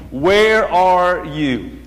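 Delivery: slow, drawn-out call with falling end; the Father calling. Acted. Preacher quotes the Father in Genesis, slow calling question. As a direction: stretch each word out deliberately. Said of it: "you hear the Father's voice saying".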